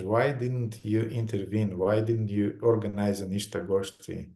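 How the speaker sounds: usual speaking voice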